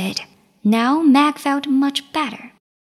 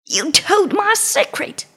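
roaring tyrant king; 7 semitones higher than usual. Acted. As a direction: roar it at the traitor. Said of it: "the king roared at Meg".

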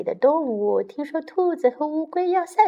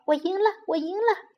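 excited; 8 semitones higher, faster than usual. Acted. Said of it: "乌龟很兴奋地说".

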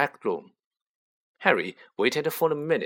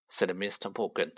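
ordinary delivery, nothing marked

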